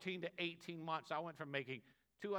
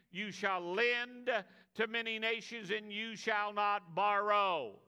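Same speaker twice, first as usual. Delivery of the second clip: ringing pulpit reading of Deuteronomy, a bit louder; scripture reading; 4 semitones higher, 5 dB louder than usual. Acted. Preacher reading the blessings to the congregation, proclaiming.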